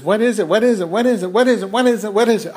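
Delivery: high, singsong nagging, relentless; insistent kid. Retold or imitated. Nephew insisting the aunt tell, mock pestering. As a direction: imitate a child hammering the same question. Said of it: "he kept insisting".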